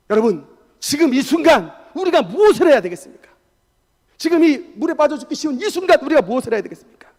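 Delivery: loud, urgent rhetorical questions; impassioned preaching. Acted. Preacher asks congregation what to do at the moment of danger, impassioned. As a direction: press the congregation with a forceful question.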